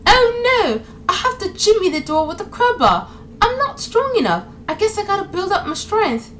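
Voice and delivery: high, mock-helpless damsel; mock a feeble game heroine; Lara Croft. Mocking Lara Croft's helpless lines.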